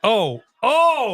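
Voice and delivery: high excited "oh, oh". Real, not acted. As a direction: jump up at the action. Excited reaction.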